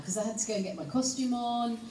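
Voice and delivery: ordinary talk, nothing marked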